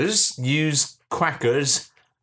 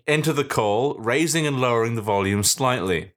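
normal talking voice

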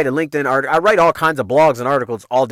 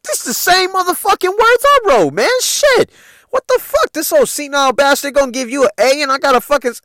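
pissed off; 10 semitones higher than usual. Retold or imitated. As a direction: rant in outrage, voice pitched way up. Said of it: "I was fucking pissed off".

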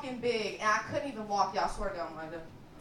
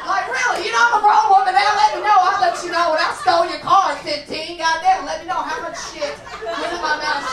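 higher and louder indignant rant; mock outrage; 5 semitones higher, 8 dB louder than usual. Daughter demanding mom tell the truth, loud mock outrage. Yelling at mom, grown woman.

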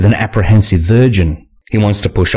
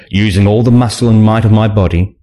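usual speaking voice

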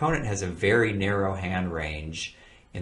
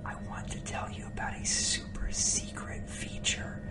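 hushed conspiratorial whisper; secret whisper; 7 semitones lower than usual. Acted. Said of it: "I have to whisper because it's so secret".

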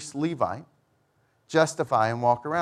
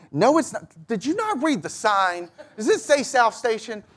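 higher, mocking disbelief; exasperated inner thought; 8 semitones higher than usual. Pastor retelling his annoyance at tourists, exasperated higher voice. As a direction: roll your eyes at the clueless travelers. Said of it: "you don't say that out loud".

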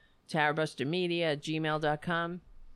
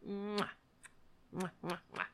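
kissy noises; 10 dB quieter than usual. Real, not acted. Kissing noises at the cat.

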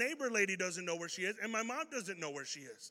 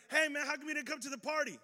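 mock friend; 8 semitones higher, 4 dB louder, faster than usual. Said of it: "it's like".

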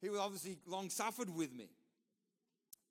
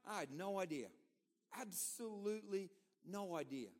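normal talking voice

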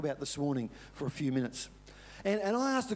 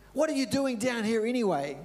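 higher, sharp scolding question; angry older brother; 7 semitones higher, 4 dB louder than usual. Retold or imitated. Older brother scolding David at the battlefront, mock angry. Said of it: "And he got angry".

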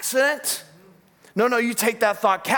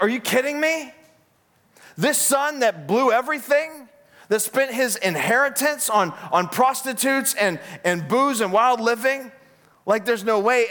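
high-pitched indignant disbelief; acted-out sibling outrage; mad older brother; 6 semitones higher than usual. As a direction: protest the unfairness, pitch up. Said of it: "The brother gets mad".

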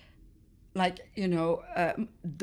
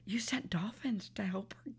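whispered, awed prayer; inner thought; 10 dB quieter than usual. Retold or imitated. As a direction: whisper it privately to God. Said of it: "I'm going through my mind".

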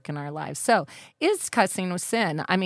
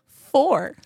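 ordinary talk

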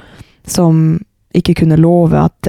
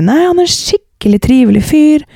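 gushing friend; 6 semitones higher than usual. Retold or imitated. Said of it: "Og hun bare".

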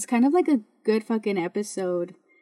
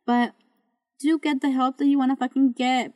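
ordinary talk

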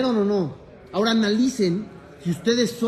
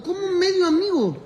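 mock astonishment; 7 semitones higher than usual. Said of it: "repite la pregunta con asombro".

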